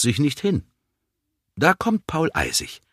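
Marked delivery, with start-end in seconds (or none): none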